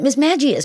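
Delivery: three syllables in "Magius", g softened; magic-like pronunciation. Shown as a demonstration. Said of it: "the word magic is almost in there".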